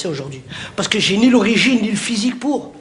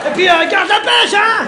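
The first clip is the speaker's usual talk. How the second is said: mock boss cheer; 8 semitones higher, 3 dB louder than usual. Mock boss encouraging spectator.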